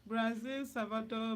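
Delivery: ordinary talk, nothing marked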